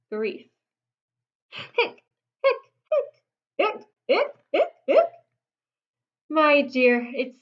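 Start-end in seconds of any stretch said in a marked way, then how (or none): 2.4-5.1 high, sharp mock hiccups; hiccupping. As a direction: pop out each hic up high. Papa Bear's hiccups.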